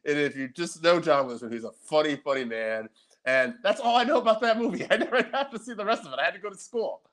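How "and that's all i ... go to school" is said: high, giggly talk; laughing. Real, not acted. Telling the co-host he never saw the rest, laughing. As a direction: laugh through the lines.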